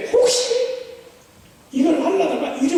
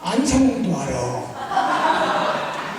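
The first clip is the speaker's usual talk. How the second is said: very slow, lower smug reply, with a laugh; knowing drawl; 6 semitones lower, much slower than usual. Shown as a demonstration. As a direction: drag it out like you know everything. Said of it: "그러면 이렇게". (아주 느리게 낮추어 비웃듯 아는 척)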